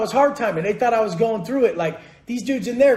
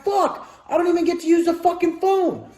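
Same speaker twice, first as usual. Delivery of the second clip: exasperated inner thought; 6 semitones higher than usual. Retold or imitated. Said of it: "I'm like".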